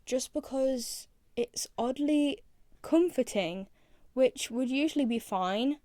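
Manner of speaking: normal talking voice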